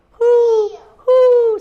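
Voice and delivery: high, loud, drawn-out hoots; owl hoot. A narrator's reading. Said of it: "said the owl".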